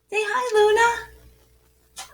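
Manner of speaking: high, slow sing-song coaxing; coaxing pet talk. Real, not acted. Mom coaxing her poodle Luna to greet viewers, high slow sing-song. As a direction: sweetly coax the little dog to say hi.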